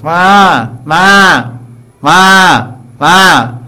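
high, loud calf cry for its mother; calf bleating. Retold or imitated. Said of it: "vatsa, she goes".

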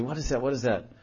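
a quiet, wrinkled-nose mutter at a stink; disgusted. Retold or imitated. Villager sniffing a bad smell in the city, muttering in disgust. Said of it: "some bad smell is there".

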